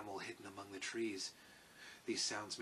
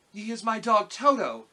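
put-on high, loud girlish voice; little girl; 10 semitones higher, 14 dB louder than usual. A narrator's reading. Dorothy introduces her dog to the lion, high girl's voice. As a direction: speak up proudly, pitched high.

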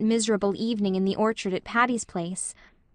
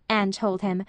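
ordinary delivery

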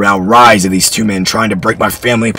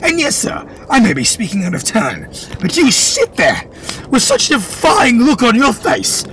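high, defiant voice; boy's high defiant scolding; defiant accusation; 12 semitones higher than usual. Acted. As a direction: accuse him to his face. Boy keeps accusing, higher voice.

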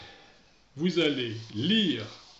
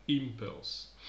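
"impulse" stressed on its first syllable. Shown as a demonstration. Teacher demonstrates noun stress rule, "impulse" stressed on first syllable. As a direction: say "impulse" as IM-pulse, falling away after. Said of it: "l'accent tonique sera sur la première syllabe".

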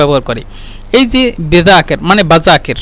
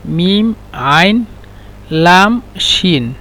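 spelling out letters; 3 dB quieter, slower than usual. Shown as a demonstration. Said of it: "মালিশ, এটা হলো আইন".

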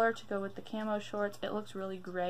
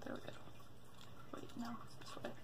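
whispering; 16 dB quieter, much faster than usual. Real, not acted. Whispering while building toy.

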